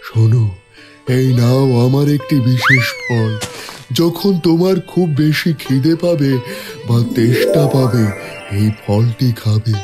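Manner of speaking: kindly tree giving a blessing; talking tree. Acted. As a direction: bless the traveller as a wise tree. Tree gives a magic fruit.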